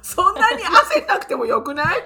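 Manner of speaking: laughing. Real, not acted. Teasing friend fighting sneeze.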